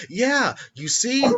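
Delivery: put-on high, slow little-boy voice; Henry. Little boy explains himself to her, high kid voice. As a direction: draw it out high and loud like a kid. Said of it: "he explains that".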